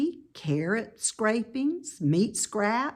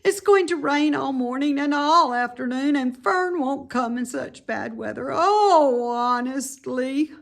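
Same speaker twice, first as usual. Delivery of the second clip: high, louder whining pig voice; lonely young pig; 6 semitones higher, 5 dB louder than usual. Acted. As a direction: piglet close to tears. Wilbur lonely, near tears.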